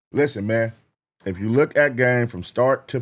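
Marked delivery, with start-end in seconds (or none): none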